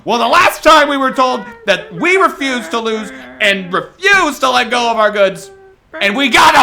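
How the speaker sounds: rousing, building political speech; rally the people to rise up; stirring speech. Acted. Impassioned closing speech.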